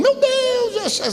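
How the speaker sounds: very high, drawn-out mock wail; choro desesperado imitado. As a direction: mimic a desperate wife crying out high. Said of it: "A minha mulher chegou chorando". (lamento agudo e desesperado, imitado)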